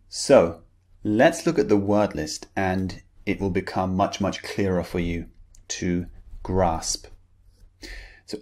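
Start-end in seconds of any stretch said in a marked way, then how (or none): none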